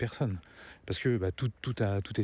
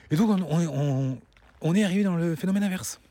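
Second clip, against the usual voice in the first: emphatic; 7 semitones higher, 5 dB louder than usual. Real, not acted. Emphatic rant point.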